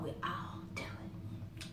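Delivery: hushed, confessing the habit; conspiratorial whisper. Acted. Confiding to viewers that all belles spike their tea, whispered. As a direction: whisper it like a shared secret.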